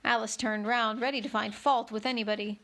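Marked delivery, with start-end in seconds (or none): none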